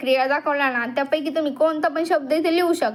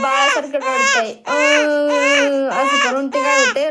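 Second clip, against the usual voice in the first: high, loud mock baby wail; crying like a baby; 11 semitones higher, 6 dB louder, slower than usual. Shown as a demonstration. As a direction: wail like a baby to show the children. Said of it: "Cry like a baby". (बाळासारखं रडण्याची मुद्दाम केलेली नक्कल)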